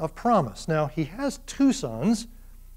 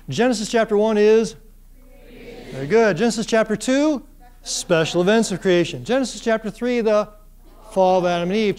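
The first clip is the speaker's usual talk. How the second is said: energetic classroom drill; 3 semitones higher, 4 dB louder than usual. Acted. Teacher drilling students on Genesis chapters, loud and upbeat. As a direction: pump the class up as you quiz them.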